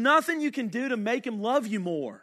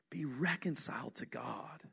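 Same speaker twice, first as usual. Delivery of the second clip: "Be reconciled to God" said much softer and lower, almost confiding; hushed appeal; 8 semitones lower, 14 dB quieter than usual. Acted. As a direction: drop to an intimate hush on "Be reconciled to God". Hushed repeat of the solution.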